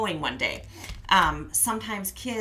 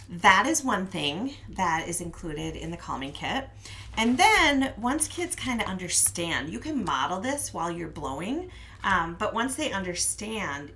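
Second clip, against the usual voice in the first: ordinary delivery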